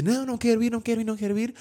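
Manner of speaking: put-on high, whiny kid begging not to go; pleading child. Terrified boy begs his father not to go downstairs, high pleading. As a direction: beg like a terrified little boy. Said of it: "a dizer".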